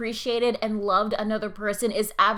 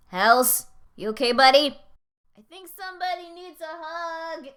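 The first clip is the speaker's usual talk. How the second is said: ordinary talk, nothing marked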